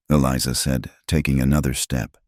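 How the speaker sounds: ordinary delivery, nothing marked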